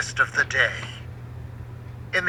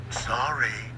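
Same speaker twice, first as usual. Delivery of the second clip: small, much softer apology; meek sorry; 4 semitones lower, 8 dB quieter than usual. A narrator's reading. Scolded boat apologizing to the sailor, meek and soft. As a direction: shrink back as you say it. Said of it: "the boat replied".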